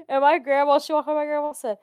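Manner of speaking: ordinary talk, nothing marked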